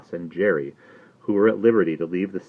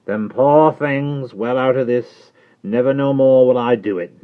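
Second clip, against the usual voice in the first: slow, higher, loud solemn vow; Jerry vowing to Miss Pross, slow and solemn; solemn trembling Jerry; 6 semitones higher, 8 dB louder than usual. Acted. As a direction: swear it slowly and earnestly. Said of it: "an ashy and solemn visage".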